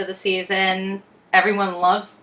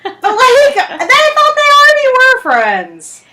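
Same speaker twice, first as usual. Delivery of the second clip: incredulous; 7 dB louder, slower than usual. Real, not acted. Incredulous aside about the characters.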